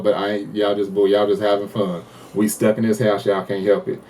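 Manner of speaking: ordinary talk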